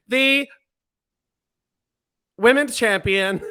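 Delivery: The, long pause, women's champion; weird pause after The. Retold or imitated. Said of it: "a weird pause".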